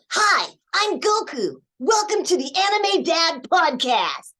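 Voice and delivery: high, loud, drawn-out hero greeting; Goku. Shown as a demonstration. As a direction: announce it as an exuberant anime boy hero. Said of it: "say in Goku or Gohan's voice".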